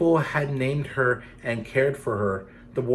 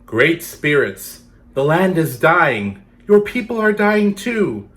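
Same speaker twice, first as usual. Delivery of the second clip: pleading dancers; 6 semitones higher, 8 dB louder than usual. Acted. Dancers beg the great spirits, loud plea. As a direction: cry out to the spirits for rain. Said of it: "the long line of dancers sang".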